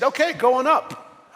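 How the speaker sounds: very high, loud imitated receptionist; mock-cheery, piping and loud; chirpy receptionist. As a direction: put on a high-pitched voice to send a visitor up. Retold receptionist sending him upstairs.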